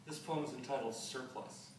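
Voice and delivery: ordinary talk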